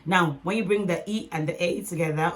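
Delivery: normal talking voice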